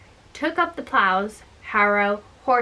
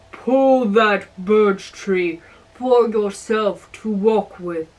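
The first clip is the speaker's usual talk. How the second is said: a hint of a big old giant; slower than usual. A narrator's reading. Old giant telling the lad to pull the tree, lightly voiced.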